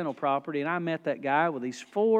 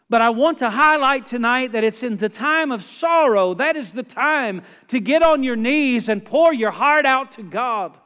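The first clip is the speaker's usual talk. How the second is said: higher and louder, driving toward the climax; fervent preaching; 5 semitones higher, 8 dB louder than usual. Real, not acted. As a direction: pour it out to the whole church.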